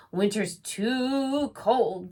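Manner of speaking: slow, loud grumbling bear; reluctant bear. Acted. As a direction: drag it out like a bear who hates the cold. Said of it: "Bear shuddered".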